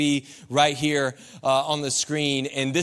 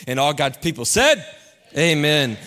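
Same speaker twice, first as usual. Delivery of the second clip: rally the room to answer; preacher's call; 3 semitones lower than usual. Real, not acted. Preacher leading church to say amen. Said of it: "leading prayer".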